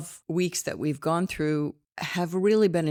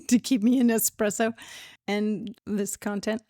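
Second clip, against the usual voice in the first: laughing; 6 semitones higher, 7 dB louder than usual. Real, not acted. Laughing thanks for coffee.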